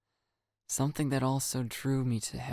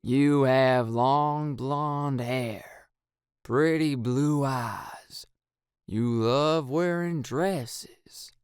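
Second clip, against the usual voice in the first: hypnotist voice; 3 semitones higher, 4 dB louder, much slower than usual. Acted. Hypnotist reading induction.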